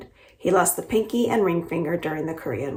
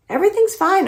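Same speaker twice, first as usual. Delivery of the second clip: high, louder call back; shouting back; 13 semitones higher, 6 dB louder than usual. A narrator's reading. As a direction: shout it back to the next room. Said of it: "Oma shouts back".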